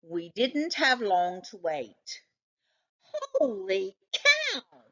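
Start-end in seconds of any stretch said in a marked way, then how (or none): 3.0-4.8 high, slow startled cry; startled father yelps in surprise. Acted. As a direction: jump at what's in the fridge. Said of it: "Mister Monroe yelped".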